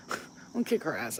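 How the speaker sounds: ordinary delivery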